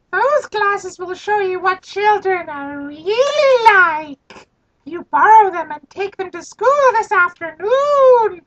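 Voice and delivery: whisper-plot like a sly gnome. Acted. Gnome urging Frida to take glasses to school, sly and squeaky. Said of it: "The gnome said slyly".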